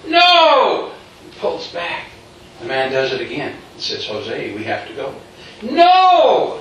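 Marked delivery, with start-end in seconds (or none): 0.1-0.9 high, loud, drawn-out "No!"; refusing shout. Retold or imitated. Jose resists the man pulling him away, refusing shout. 4.1-5.1 low, soft voice of the man; quiet, low insistence; calm summons. Retold or imitated. Man standing over Jose summons him, calm and low. 5.6-6.6 high, loud, drawn-out "No!"; refusing shout. Retold or imitated. Jose resists the man pulling him away, refusing shout.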